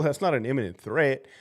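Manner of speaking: normal talking voice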